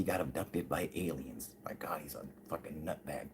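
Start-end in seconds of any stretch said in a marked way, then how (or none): 1.6-3.3 hushed, unvoiced mockery; whispered aside. Whispering to viewers that the pilot is a nutbag. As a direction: breathe it like a secret insult.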